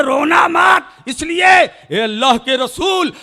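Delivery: impassioned sermon climax; fervent preaching. Acted. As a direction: build the warning to a roar. Climax of the moon's plea.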